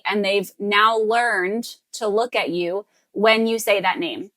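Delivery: ordinary talk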